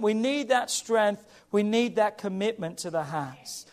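ordinary talk

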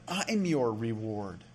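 "I'm" drawn out with deliberate emphasis; stress on I'M. Retold or imitated. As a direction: lean slowly on "I'm". Contrasts providing with being reward.

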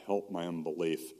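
normal talking voice

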